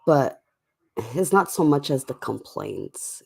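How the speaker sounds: usual speaking voice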